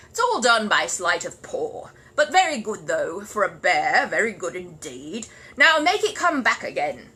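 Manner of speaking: loud, slow, pompous praise; gruff know-all neighbour. Acted. Grumpy neighbour praising the bear grudgingly, pompous. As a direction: condescend to the bear.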